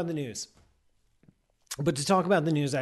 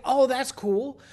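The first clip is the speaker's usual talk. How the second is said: mock delight; 9 semitones higher, 5 dB louder, slower than usual. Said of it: "I was like".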